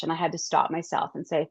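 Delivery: ordinary delivery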